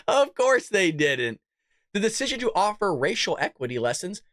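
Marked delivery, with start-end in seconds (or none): 0.1-1.4 slow, drawn-out scoff in full voice; scoffing repeat. Real, not acted. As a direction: stretch it out slowly, voice falling at the end. Repeats the aside out loud.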